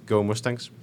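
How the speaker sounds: usual speaking voice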